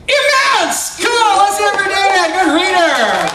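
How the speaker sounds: showman crowing over a hit; big victorious shout to the audience; triumphant shout. Acted. Triumphant reveal, rallying applause.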